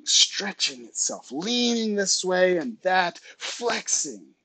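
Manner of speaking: slow, elongated words; drawn-out stretching. A narrator's reading. Narrator showing a brain moving, words stretched slowly. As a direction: stretch each verb out as if flexing.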